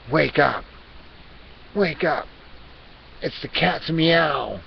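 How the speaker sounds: loud, insistent cat's wake-up call; rousing call. Pretend. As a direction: punch it out to rouse sleepers. Cat urges viewers to wake.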